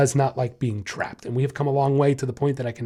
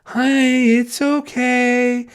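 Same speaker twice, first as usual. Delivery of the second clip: imitating a nervous new vet tech, much higher and drawn out; ridiculous tone; 9 semitones higher, 5 dB louder, much slower than usual. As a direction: coo it slowly, high and sugary, like someone creeping toward a scared dog. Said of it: "using a ridiculous tone".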